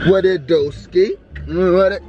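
1 playful mock greeting.

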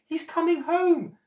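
high, loud panicked cry; enormous lady panicking. Acted. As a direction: alarm as he approaches. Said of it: "cried the enormous lady".

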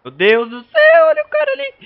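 high, loud panicked cry; panicked shout. Real, not acted. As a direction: scream it in a panic. Panics at enemy in game. (grito de pânico)

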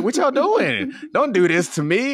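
playfully begging them not to do this; mock plea. Fan teasing reunited duo, high mock plea.

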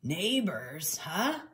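a soft, grudging grunt; grunted reply. Acted. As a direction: quietly mull it over. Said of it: "Ned grunted".

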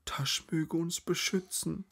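slow, high, breathy plea; terrified whisper. A narrator's reading. Terrified woman prays for protection in the dark, high whisper. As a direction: whisper a desperate prayer. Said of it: "flüsterte Lazaralen".

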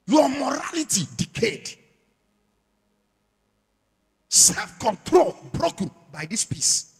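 loud, high, shouted sermon; fiery preaching. Acted. Preacher warns the congregation about phones, shouting. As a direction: thunder a warning at the congregation.

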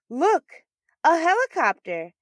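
high, loud, thrilled call from the crowd; excited shout. Acted. A bystander in the crowd spotting a helicopter, excited high shout. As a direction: point up and yell with delight.

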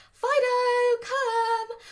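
high, loud, sing-song recall call; excited shout. Shown as a demonstration. Trainer showing owners the recall call, excited and loud. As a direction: call the dog back full of excitement. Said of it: "you're gonna shout, full of excitement".

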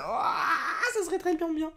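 high laughing squeal; laughing excitement. Real, not acted. Youtuber imagining the Dialga pull, laughing. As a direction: giggle at the dream pull.

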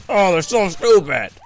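loud, high outburst of frustration; frustrated yell. Real, not acted. Gamer raging at teammates who retreated and died, frustrated yell. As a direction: explode at your useless teammates.

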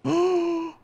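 sharp high delighted gasp; excited gasp. Real, not acted. Player spots kelp underwater, excited gasp. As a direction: gasp at the sight of treasure.

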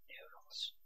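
breathless whispered curse; defeated whisper. Real, not acted. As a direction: give up in a sigh.

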